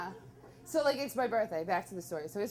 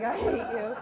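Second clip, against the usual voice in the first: laughing mock grudge; 4 dB louder than usual. Friends say they're busy.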